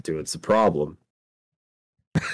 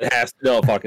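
ordinary delivery

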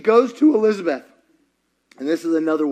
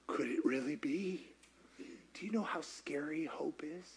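very soft, fragile question; hushed hope; 3 semitones lower, 18 dB quieter than usual. Acted. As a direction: barely dare to say it.

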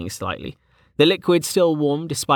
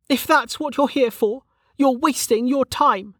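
high-pitched dismissive character voice; Velika, dismissive; 13 semitones higher than usual. Acted. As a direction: brush him off in a much higher voice.

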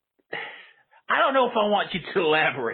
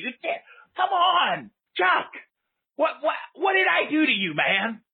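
exasperated plea; 7 semitones higher than usual. Acted. Host pleading with rude caller.

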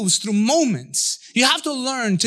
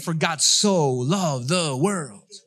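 very slow reverent reading; slow, savoring; 4 semitones lower, much slower than usual. Acted. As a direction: linger on every word. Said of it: "You're like".

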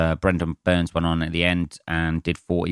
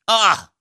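frustrated yelp; 13 semitones higher, 5 dB louder than usual. Real, not acted. Rain spoils painting plans.

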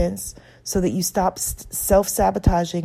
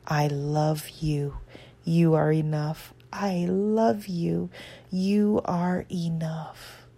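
slow, soft whisper; tender mantra; 4 dB quieter, much slower than usual. Shown as a demonstration. Comforting herself in the memory, slow soft whisper. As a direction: breathe it gently, slowly. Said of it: "say to yourself".

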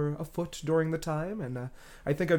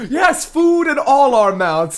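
slow, loud and high, laughing; 10 semitones higher, 12 dB louder, slower than usual. Real, not acted. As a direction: laugh through the words. Joking along about full mouths.

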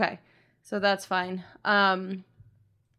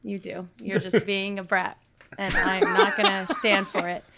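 ordinary talk, nothing marked

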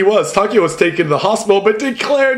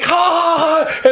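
high imitated prankster's laugh; hammed-up "ha, ha, ha" at someone's expense; mock laugh; 9 semitones higher than usual. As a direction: act out gleeful laughing, pitched way up. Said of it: "and he's like".

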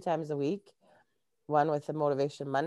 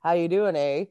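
mock Canadian greeting ending in a tagged eh; joke Canadian eh; 2 semitones higher, 5 dB louder than usual. Guest jokes how Canadians say eh, mock accent. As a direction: ham up the stock Canadian eh. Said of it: "in the joke, it's like".

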